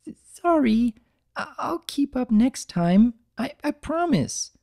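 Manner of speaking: high, stuttering, tearful; a timid mare near tears; stammering apology. Acted. Fluttershy apologising to the duck, high stammering voice.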